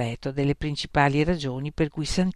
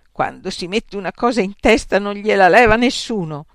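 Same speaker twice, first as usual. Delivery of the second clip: louder, scornful complaint; bitter; 4 semitones higher, 8 dB louder than usual. Acted. Santippe venting to friends about Socrates, bitter and loud. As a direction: lash out at the stubborn husband.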